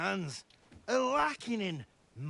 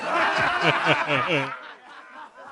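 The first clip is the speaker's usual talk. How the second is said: a big rising belly laugh; hearty laugh; 4 semitones lower, 9 dB louder than usual. Acted. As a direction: laugh loud at your own joke. Laughing at his own joke.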